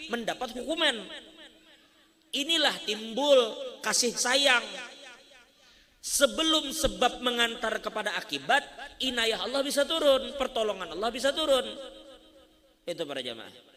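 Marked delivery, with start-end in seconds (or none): 2.3-11.7 loud, raised preaching voice; rising sermon delivery, loud and urgent; forceful preaching. Acted. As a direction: preach it out to the congregation, pushing hard. Preacher builds to full force.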